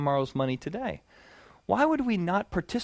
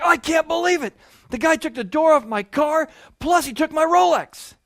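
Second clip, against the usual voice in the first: much higher and louder, a hammed-up furious yell; outraged lawyer; 12 semitones higher, 7 dB louder than usual. Preacher voicing the lawyer in a mock furious, high, loud yell. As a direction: play the lawyer losing it completely over his watch. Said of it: "Now he's even more mad".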